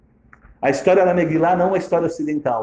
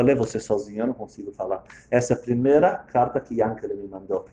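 quiet, choked, subdued speech; a touch of tearful defeat; mild crying; 5 semitones lower, 4 dB quieter than usual. Acted. Father handing over the letter, quietly and a little tearful. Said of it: "E Reb Avrom começa a chorar".

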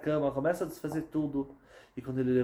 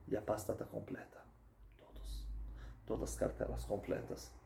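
soft, breathless whisper; whispered dread; hushed whisper; 4 semitones lower, 13 dB quieter than usual. A narrator's reading. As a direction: drop to a whisper at the shock. Narrator hushes at the discovery.

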